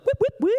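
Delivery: high whooping alarm; imitated alarm siren. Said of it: "My inner alarm. I was like".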